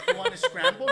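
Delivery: high laughing quote. Real, not acted. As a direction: squeal it through laughter.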